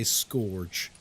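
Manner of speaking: "scourge" said wrongly as SKORJ; mispronunciation. Retold or imitated. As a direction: say "scourge" to rhyme with gorge. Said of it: "when I hear people say".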